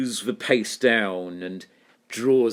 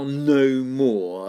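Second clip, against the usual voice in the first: "no more" each hit hard; both syllables stressed; 4 semitones higher, 4 dB louder than usual. Shown as a demonstration. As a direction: give "no" and "more" equal weight. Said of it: "are both stressed syllables".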